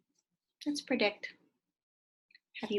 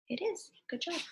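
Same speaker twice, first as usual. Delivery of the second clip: ordinary delivery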